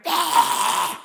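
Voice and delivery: loud, high demonstrated scream; short mock scream of frustration; venting scream. Shown as a demonstration. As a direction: let out a burst of frustration. Acts venting scream in toilet.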